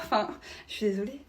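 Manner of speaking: ordinary talk, nothing marked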